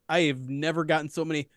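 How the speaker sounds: usual speaking voice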